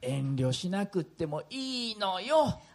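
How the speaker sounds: grandma voice. Acted. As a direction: play a sweet elderly grandma. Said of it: "するとおばあちゃんこう答えました". (おばあちゃんの声)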